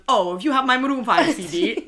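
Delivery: a light send-up of a Tracy Beaker line; lightly put-on TV voice asking about a CD; Tracy Beaker impression. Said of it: "what's it off Tracy Beaker where he goes".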